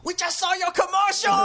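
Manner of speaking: put-on high, excited shout in Chinese accent; excited Chinese-accented dad. Dad phoning after seeing son's commercial, excited accented shouting. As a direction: burst out as a thrilled Chinese father. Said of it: "he's never this excited".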